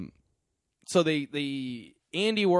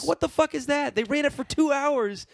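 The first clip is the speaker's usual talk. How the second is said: incredulous rant; 9 semitones higher than usual. Real, not acted.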